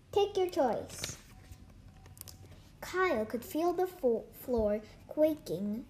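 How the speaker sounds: ordinary delivery, nothing marked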